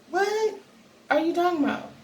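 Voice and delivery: high-pitched puzzled question; incredulous. Retold or imitated. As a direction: re-enact being baffled by a sister's claim. Acts out own puzzled reply to sister.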